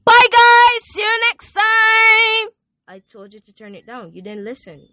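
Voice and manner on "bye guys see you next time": very high, loud, drawn-out goodbye yell; ear-splitting farewell, last word stretched; loud shouted sign-off. Real, not acted. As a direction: scream goodbye to the listeners. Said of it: "Turn down your volumes".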